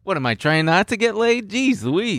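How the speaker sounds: high, sarcastic mock outrage. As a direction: squeal it in fake disbelief. Mocking Android users.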